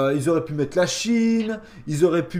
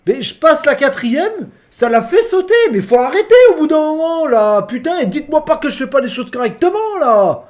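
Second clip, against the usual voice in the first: loud, high-pitched rage at the game; raging gamer; 7 semitones higher, 6 dB louder than usual. Real, not acted. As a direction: yell at the screen in frustration.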